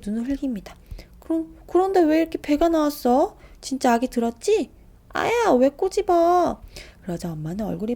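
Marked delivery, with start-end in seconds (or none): 1.2-4.7 put-on high, loud insistent child; pestering child. Little brother pestering mother in public, loud high questions. Child keeps loudly asking. 5.1-6.6 high, loud, slow yelp; pinched child. Acted. Little brother pinched by mother, high loud slow yelp.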